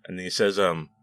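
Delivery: ordinary talk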